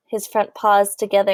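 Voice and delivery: usual speaking voice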